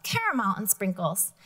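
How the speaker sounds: lively storybook reading with character voices; reading to children. Acted. Teacher reading a picture book to the class, lively. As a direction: read it aloud to the class, bright and lively.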